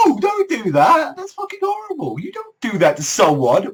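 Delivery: high-pitched protesting squeal; mock scolding. Viewer telling off students for a cruel prank, scandalised.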